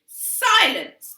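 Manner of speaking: loud, high command; demanding silence. A narrator's reading. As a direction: cut them off. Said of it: "demanded Mother".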